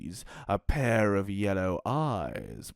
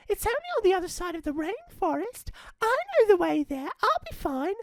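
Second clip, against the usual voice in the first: put-on much higher, quick girl voice; insistent little girl; faster than usual. Red insists to Mum she knows the way, high girl voice. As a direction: reassure Mum you are grown up. Red's voice in story.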